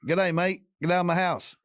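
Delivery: a friendly Aussie, not scary at all; Australian accent. Pretend. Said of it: "you can't be like the other guy".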